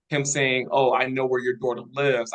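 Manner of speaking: ordinary talk, nothing marked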